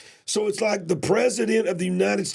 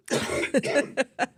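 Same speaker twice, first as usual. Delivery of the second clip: dry coughing fit; 8 semitones higher, 3 dB quieter than usual. Real, not acted. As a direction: cough hard, then recover. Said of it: "let me pause while I cough".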